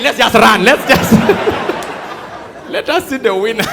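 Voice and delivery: loud, laughing call to just run; impatient mock shout. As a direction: shout it like bored runners giving up walking. Runners mocking speed walking.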